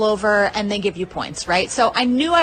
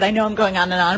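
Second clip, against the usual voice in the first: usual speaking voice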